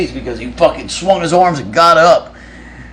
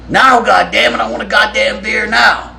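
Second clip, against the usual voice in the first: raised, higher-pitched angry yell; angry demand; 8 semitones higher than usual. Retold or imitated. Bandmate demanding a beer from the others, angry yell. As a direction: demand it like a fed-up drunk bandmate.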